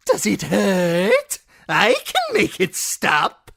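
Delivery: high, loud, mocking jester voice; cruel jester. A narrator's reading. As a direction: taunt the wounded man with glee. Shagwell taunting.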